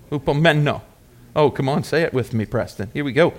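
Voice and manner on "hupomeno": Hupomeno with the stress on MEN; stress on third syllable. Shown as a demonstration. Preacher teaching congregation the Greek word, Hupomeno stressed on MEN. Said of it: "Emphasis on the third syllable".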